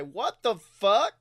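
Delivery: usual speaking voice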